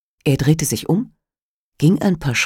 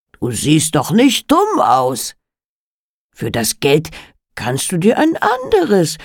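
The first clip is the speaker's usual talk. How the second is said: persuasive Alligator. Acted. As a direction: talk the girl into selling. (überredender Geschäftsmann)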